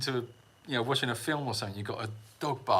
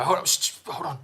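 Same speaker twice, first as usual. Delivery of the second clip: listening face demo; 5 semitones higher, 4 dB louder than usual. Shown as a demonstration.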